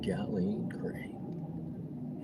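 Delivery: drawn-out whispered name, very slow; whispering the viewer's name slowly after the announcer intro. Pretend. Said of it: "in my best announcer voice".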